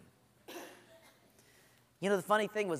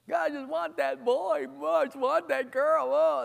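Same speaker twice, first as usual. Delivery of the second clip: higher, whiny longing; pining whine; 7 semitones higher than usual.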